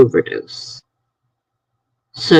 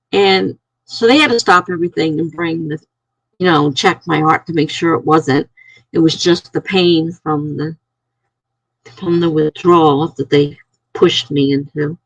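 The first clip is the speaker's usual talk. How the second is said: normal talking voice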